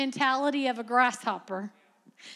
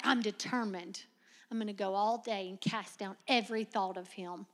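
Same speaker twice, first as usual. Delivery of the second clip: softer, low self-talk; quiet resolve; 6 semitones lower, 8 dB quieter than usual. Retold or imitated. Young woman vowing to stop thinking of Spencer, quietly. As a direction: mutter the vow to yourself. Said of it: "I'm like".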